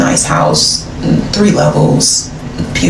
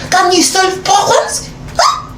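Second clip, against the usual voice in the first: higher French voice, climbing to a squeaky questioning end; put-on French accent; 7 semitones higher than usual. Girlfriend demanding a solution from her man, rising French accent. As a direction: challenge him in a mock French accent, ever higher. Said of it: "turned into a whole French woman".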